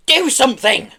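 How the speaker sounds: high, loud exasperated order; commanding. A narrator's reading. Pelly to her fallen husband, commanding. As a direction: demand action now. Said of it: "commanded Pelly".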